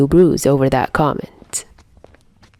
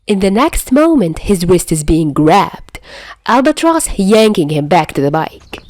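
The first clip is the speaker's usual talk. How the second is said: plain reading voice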